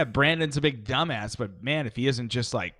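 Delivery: ordinary talk, nothing marked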